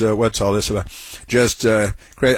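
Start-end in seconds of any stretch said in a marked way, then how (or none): none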